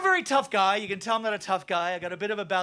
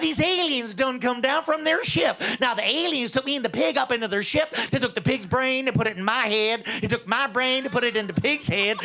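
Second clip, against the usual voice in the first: lilting country tall-tale voice; hillbilly yarn-spinner. Acted.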